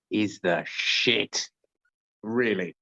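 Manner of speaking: talking through hiccups; hiccuping. Real, not acted. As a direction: hiccups breaking the words. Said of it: "I'm busy hiccuping".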